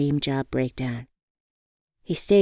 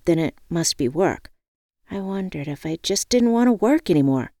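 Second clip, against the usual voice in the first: ordinary delivery, nothing marked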